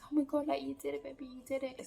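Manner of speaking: high, delighted praise; imitating boyfriend's excited praise; proud boyfriend. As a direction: cheer her on high and proud.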